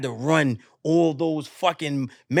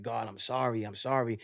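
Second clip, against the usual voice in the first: low, soft, humbled apology; tearful apology, retold; 7 semitones lower, 10 dB quieter than usual. Crying runner apologises to God, low and subdued. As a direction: apologise quietly, understated remorse. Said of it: "you just crying".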